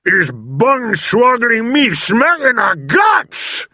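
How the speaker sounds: high, loud, drawn-out giant's bellow; indignant shout. A narrator's reading. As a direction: shout in protest at being kicked. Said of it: "shouted the gizzard gulper".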